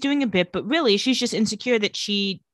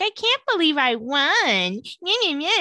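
mocking pick-me girl; 6 semitones higher than usual. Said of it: "the most tired pick-me-girl pageant bit".

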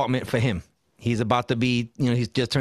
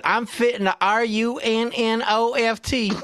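slow, high Southern spelling-out; Southern movie accent; 8 semitones higher than usual. Retold or imitated. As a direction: quote the film in a hammed-up Southern drawl. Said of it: "Where Art Thou?".

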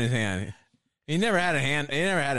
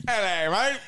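high Aussie "Hello, mate"; lightly hammed Australian accent; playful Australian accent; mock Australian; 7 semitones higher, 3 dB louder, slower than usual. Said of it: "to get them pissed".

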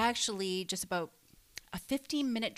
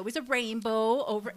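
ordinary delivery

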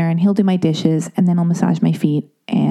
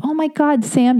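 ordinary talk

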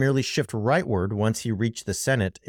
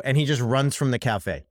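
usual speaking voice

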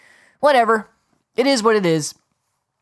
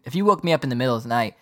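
ordinary delivery

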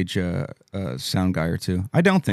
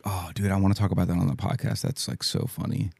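whispered; 8 dB quieter than usual. Retold or imitated. Inner thought quoted as aside.

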